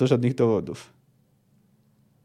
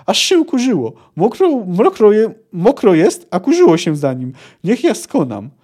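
delighted; 4 semitones higher, 5 dB louder than usual. Acted. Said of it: "zachwycił się Percival".

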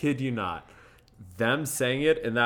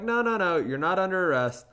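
high, louder string of denials; pitched-up mock reassurance; imitated hasty reassurance; 8 semitones higher, 5 dB louder, faster than usual. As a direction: quote the officer high and insistent. Officers hurry to reassure him.

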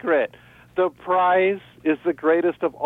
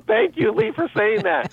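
sarcastic thanks; 8 semitones higher than usual. Retold or imitated. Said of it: "I go".

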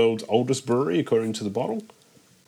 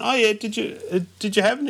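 much higher, retold eager asking; acted eager question; 11 semitones higher, faster than usual. Asking someone about the beer in a retold chat, eagerly. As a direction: lift the pitch right up to re-enact asking.

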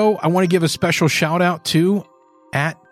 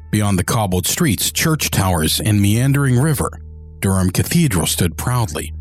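low, measured audiobook narration; story narration; 3 semitones lower than usual. A narrator's reading.

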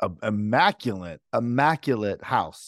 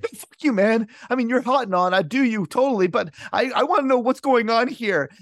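panicked protest; 11 semitones higher than usual. Acted. Eugene panicking at the nurses, high and frantic. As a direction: push it high and frantic, like waking in a strange house. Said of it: "He's like".

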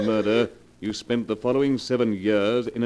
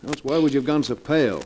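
pressing her hard, voice raised high; accusing; 4 semitones higher than usual. Acted. Husband confronting his pale wife, raised accusing voice.

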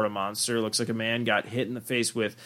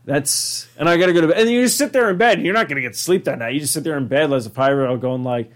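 ordinary delivery

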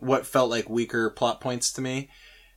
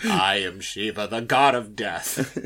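quoting Michael Clayton; 5 semitones lower, 3 dB quieter, slower than usual. Retold or imitated. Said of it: "Michael Clayton".

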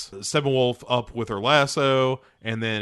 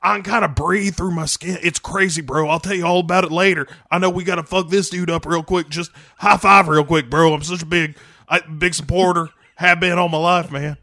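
high bro voice; chatty pumped-up bro; gym bro; 4 semitones higher, 3 dB louder than usual. Acted. Aquaman bro riff continues.

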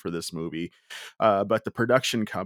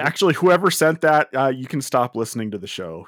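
loud, higher mock indignation; playfully disown them, voice raised; mock outrage; 4 semitones higher, 5 dB louder than usual. Mock outburst at whoever sent tagline.

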